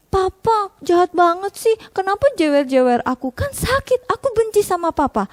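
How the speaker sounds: put-on high, sulky hurt kid; resentful child. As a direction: sulk like a punished child. (anak kesal dan sedih)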